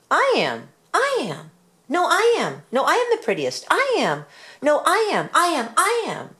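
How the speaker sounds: very high, shrill squabble of cat voices; squabbling cats. A narrator's reading. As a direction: kittens bickering over who is prettiest. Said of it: "cried hundreds and thousands".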